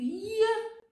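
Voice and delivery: high, loud "yeah!"; astonished outburst. Real, not acted. Host hearing that men lack confidence too, astonished outburst. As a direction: burst out high and loud in amazement. Reacts to Stéphane's confession.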